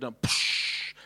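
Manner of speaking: whispered psch of water bursting out; unvoiced gushing hiss of a fire hose. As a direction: make the hose spurt with a hiss. Hose pressure imitated.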